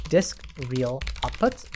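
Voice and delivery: normal talking voice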